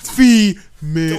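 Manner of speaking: slow, loud mocking drawl; mock the word; mocking emphasis.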